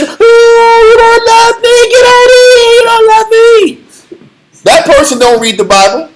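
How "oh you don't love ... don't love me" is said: high, loud mock whine; whiny crier. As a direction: wail like a self-pitying crier. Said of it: "the one that's".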